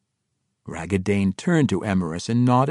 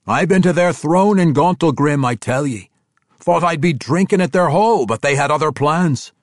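put-on a proud old dwarf, voice pitched up and loud; gruff dwarf voice; 7 semitones higher, 7 dB louder than usual. Bruner telling the dwarves of Gauntlgrim, dwarf voice. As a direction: boast about the throne hall like a rough dwarf.

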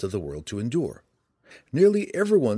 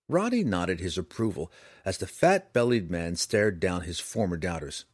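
plain reading voice

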